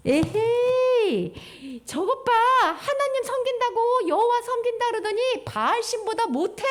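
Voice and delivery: high, drawn-out sneering jeer; mocking neighbours. Neighbouring nations jeering at Israel, mocking. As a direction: taunt them with scorn. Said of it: "옆에 있는 나라 사람들이 뭐랬는 줄 알아?".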